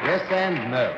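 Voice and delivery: put-on disguised Brooklyn accent; a put-on Brooklyn accent to hide his voice. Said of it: "I still get a kind of a Brooklyn sound from the voice".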